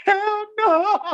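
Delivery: high, loud "hell no" of disbelief; incredulous high yelp; loud high outburst. Real, not acted. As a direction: shout it up high in shock. Repeated disbelief.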